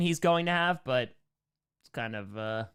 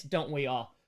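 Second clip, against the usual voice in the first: normal talking voice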